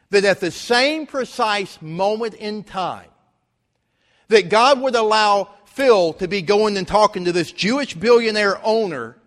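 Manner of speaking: loud, building sermon delivery; preaching at full force. Acted. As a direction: drive the point home to the congregation. Preaching climax.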